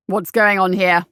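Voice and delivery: a curt officer's challenge; brisk. A narrator's reading. Captain catches the sentries slacking: brisk challenge. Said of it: "a brisk voice asked".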